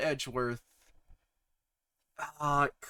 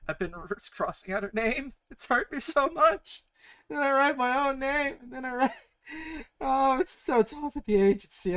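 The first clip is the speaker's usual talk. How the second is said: hammed-up whining; 9 semitones higher than usual. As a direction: whine it like a mock tragedy. Mock woe about agency.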